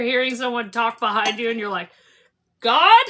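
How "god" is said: loud, high, sharply rising question; mock bewildered question. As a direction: call out as if a voice came from nowhere. Hearing a mystery voice behind her.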